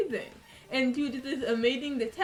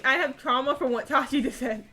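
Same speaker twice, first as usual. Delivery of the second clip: louder, laughing begging; laughing plea; 3 semitones higher, 4 dB louder than usual. Real, not acted. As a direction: beg through laughter.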